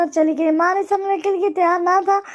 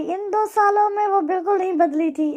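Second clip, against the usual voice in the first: ordinary delivery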